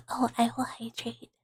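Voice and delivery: ordinary delivery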